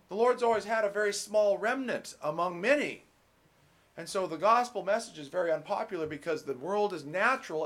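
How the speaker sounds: fervent pulpit preaching. Acted. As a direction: preach it out to the congregation. Preaching at full force.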